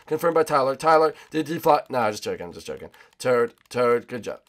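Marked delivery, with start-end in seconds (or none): none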